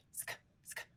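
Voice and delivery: a whispered sk, sk sound on its own. Shown as a demonstration. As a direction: isolate the sk sound softly. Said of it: "The S C H is a S K sound".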